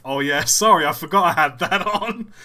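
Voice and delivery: loud, breaking into real laughter; words bubbling up into a big laugh; laughing apology. Real, not acted. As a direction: apologise while cracking up. Bursts out laughing while apologising.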